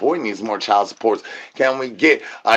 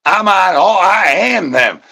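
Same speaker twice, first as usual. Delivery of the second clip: loud, higher boast, slowing down; grand proclamation; 5 semitones higher, 5 dB louder than usual. Acted. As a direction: proclaim it like a god.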